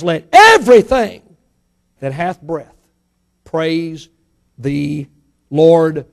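each word set apart by pauses; "Let everything that hath breath praise the Lord" spoken slowly with a break before each word; slow deliberate reading. Shown as a demonstration. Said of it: "he's emphatic about that".

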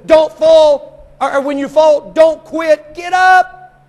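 high, loud rallying cry; urgent shout. Acted. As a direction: shout them back onto their feet. Preacher shouting God's urging.

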